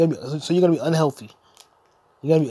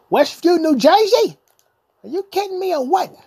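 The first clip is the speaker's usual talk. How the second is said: excited outburst; 12 semitones higher, 6 dB louder than usual. Acted. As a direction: gush like a thrilled fan.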